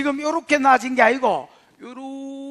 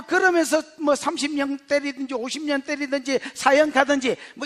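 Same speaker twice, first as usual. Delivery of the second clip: ordinary talk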